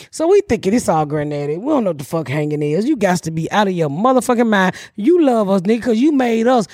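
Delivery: normal talking voice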